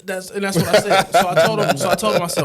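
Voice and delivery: loud, amused retelling through laughter; laughing while talking. Real, not acted. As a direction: push the story out through a laugh. Telling the story while laughing.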